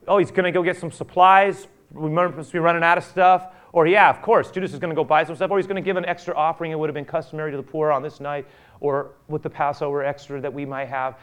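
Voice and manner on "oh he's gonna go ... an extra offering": loud mock musing. Voicing disciples' guesses.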